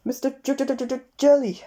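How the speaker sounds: high, frightened stutter; stammering. A narrator's reading. Mr Jelly telling the tramp his name, high nervous stammer. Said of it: "stammered Mister Jelly".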